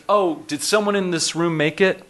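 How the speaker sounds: a touch of mock surprise; mock innocent jab. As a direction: play it as a feigned innocent dig. Steve's sarcastic jab retold.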